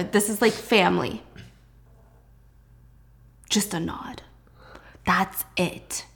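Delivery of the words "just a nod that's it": whispered. Shown as a demonstration. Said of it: "This is how they all greet".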